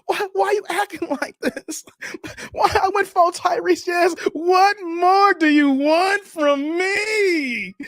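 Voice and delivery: mock-sobbing high shout, begging her; fake crying plea. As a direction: ham up a crying, begging ex on the phone. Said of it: "I started crying on the phone".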